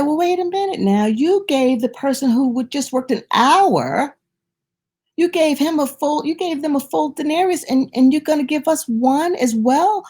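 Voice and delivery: high, aggrieved complaint; voicing workers' indignant grumbling; indignant workers. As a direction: protest the unfairness.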